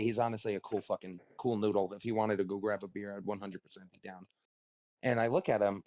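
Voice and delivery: normal talking voice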